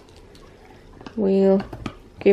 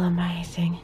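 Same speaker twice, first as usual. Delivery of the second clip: whispering; 2 semitones lower, 6 dB quieter than usual. Real, not acted. Whispered aside about candles.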